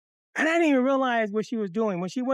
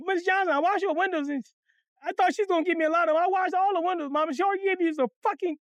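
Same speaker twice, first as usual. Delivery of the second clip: high, whiny rambling complaint; tearful kid tattling, pitched way up; mock crying; 7 semitones higher than usual. Boy tells mama what happened, mock crying. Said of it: "And Pelly out there crying".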